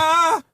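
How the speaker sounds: high-pitched "ah!" as the back seizes; retold cry of pain. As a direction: cry out as if your back just went. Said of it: "I was like".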